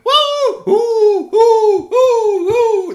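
high, loud happy hooting; joyful owl hoots. Acted. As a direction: hoot for joy like an owl.

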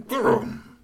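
high imitated engine noise; engine vroom. Truck stopping, high engine noise. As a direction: rev and die out.

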